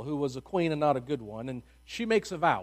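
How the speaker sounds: ordinary talk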